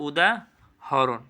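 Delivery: "উদাহরণ" slowly as উদা, pause, হরোন; split pronunciation. Shown as a demonstration. Teacher checking students follow, "উদাহরণ" slow and split. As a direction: break "উদাহরণ" after উদা. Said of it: "বুঝতে পারছো".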